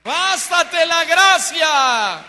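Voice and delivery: drawn-out, high, full-voiced cry; long ringing pulpit proclamation; triumphant preacher's shout. Real, not acted. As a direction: shout it slowly as the sermon's climax. Climax cry of the sermon.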